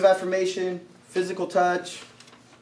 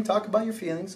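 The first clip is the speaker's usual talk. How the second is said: ordinary delivery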